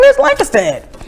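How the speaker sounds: high-pitched, puzzled retort; acted-out confused question; mock bafflement. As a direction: pitch it way up, incredulous. Acts baffled reaction to local pronunciation.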